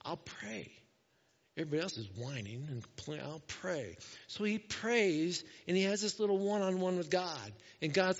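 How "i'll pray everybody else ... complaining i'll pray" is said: soft, low resigned mutter; discouraged, frustrated. Acted. Moses turning to prayer amid whining, quiet discouraged resignation. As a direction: say it quietly, worn down. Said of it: "in the midst of his discouragement and frustration".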